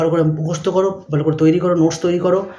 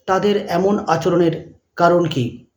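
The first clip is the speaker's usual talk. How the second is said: dictating; much slower than usual. Shown as a demonstration. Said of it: "জিজ্ঞাসা চিহ্ন দাও".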